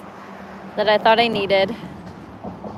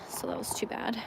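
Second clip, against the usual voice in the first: whisper; 14 dB quieter than usual. Real, not acted. Quiet aside after losing lure.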